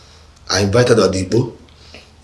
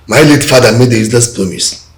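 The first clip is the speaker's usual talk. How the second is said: loud, raised, forceful voice; angry declaration; 4 semitones higher, 8 dB louder than usual. Acted. Son rejecting his late father's promise, angry and loud. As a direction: denounce it loudly and bitterly.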